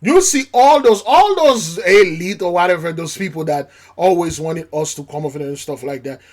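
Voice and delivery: usual speaking voice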